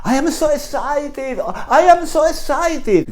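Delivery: high, slow, bubbly American enthusiasm; gushing American. Retold or imitated. Spanish teacher mocking American tourists, high and gushing. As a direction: mimic an overexcited American.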